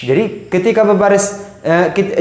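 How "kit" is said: normal talking voice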